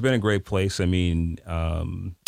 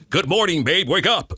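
much higher, hammed-up announcer; 9 semitones higher than usual. Shown as a demonstration. Coach mocking an announcer voice for a wife greeting. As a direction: overdo the radio voice on a tender line. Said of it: "I'm not gonna go".

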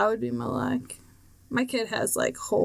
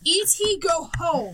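high, emphatic order to leave; mock command; 5 semitones higher than usual. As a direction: playfully order the visitor out.

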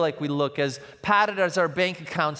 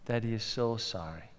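put-on much softer, gentle sorry to a child; tender apology; 7 semitones lower, 11 dB quieter than usual. Father tucking daughter in, softly apologizing. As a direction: whisper an apology at bedtime. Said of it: "I look at her and I say".

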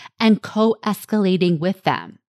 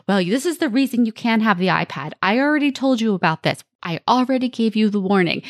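fast, mildly exasperated over-explaining; rushed lecturing parent; faster than usual. Retold or imitated. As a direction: rattle off justifications at a child. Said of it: "And instead we're saying".

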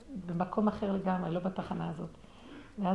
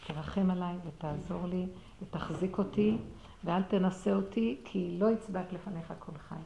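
quiet pleading prayer; 4 semitones lower, 8 dB quieter than usual. Retold or imitated.

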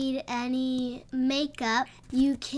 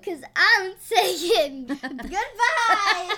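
laughing; 7 semitones higher, 5 dB louder, slower than usual. Real, not acted. Cheeky sign-off, laughing.

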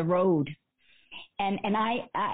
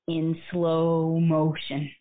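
drawn out, slow and lower; very slow, lowered delivery; slow motion; 4 semitones lower, much slower than usual. Shown as a demonstration. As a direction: stretch every word like a slowed film. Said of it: "they sort of show it going in slow motion".

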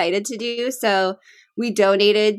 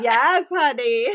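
slow, much higher put-on voice of the dad; mock old dad; 5 semitones higher, slower than usual. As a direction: drawl it sweetly as the old father. Friend voices the dad answering.

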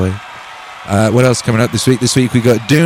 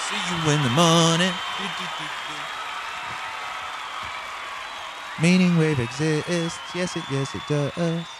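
fading, ever softer goodnight; tucking in at bedtime; 2 semitones higher, 13 dB quieter, slower than usual. Real, not acted. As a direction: soothe them to sleep, softer. Signing off, fading out.

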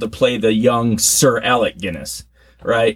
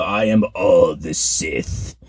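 low, slow villain intonation; Palpatine line; 5 semitones lower, much slower than usual. Retold or imitated. Host quoting the villain's movie line, slow and low. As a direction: intone it slowly like a dark lord. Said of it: "The Palpatine coming back part".

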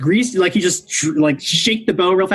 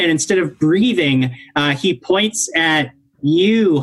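normal talking voice

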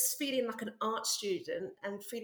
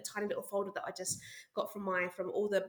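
ordinary talk

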